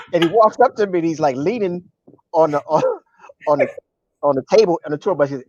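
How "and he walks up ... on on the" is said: normal talking voice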